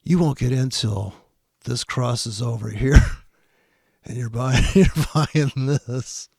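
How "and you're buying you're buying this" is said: laughing through the words. Real, not acted. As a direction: chuckle at his mistake while talking. Laughing at the video's trader.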